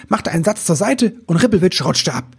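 ordinary delivery

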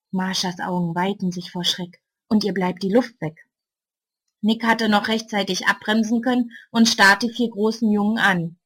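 usual speaking voice

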